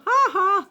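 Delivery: high taunting "ha ha" from a bird; squawky high mock laugh; mocking parrot laugh. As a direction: mimic a parrot laughing down from a tree. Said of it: "He's like".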